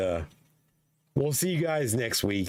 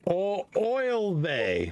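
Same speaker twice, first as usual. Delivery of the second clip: drawn-out oy vey; 9 semitones higher, much slower than usual. Pretend. Drawn-out mock Yiddish exclamation.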